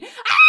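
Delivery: short, loud high-pitched yelp of a scream; imitated scream. Retelling how a friend threw up his arms, mock scream. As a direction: mimic a startled man's sudden scream. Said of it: "threw up his arms screaming".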